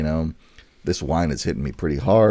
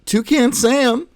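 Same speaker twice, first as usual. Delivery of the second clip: very high, slow cartoon bird voice; Toucan Sam voice; 5 dB louder, much slower than usual. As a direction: squeal the name like a cereal mascot. Mascot name called in character.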